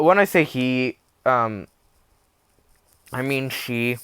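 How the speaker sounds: ordinary talk, nothing marked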